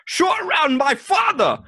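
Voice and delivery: broad caricature cabbie voice, very high; Indiana Jones taxi driver. Acted. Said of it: "the next taxi driver for Indiana Jones".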